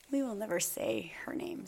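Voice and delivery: hushed unvoiced aside; whispering. Real, not acted. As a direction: drop to a secret whisper. Aside about unnamed therapist.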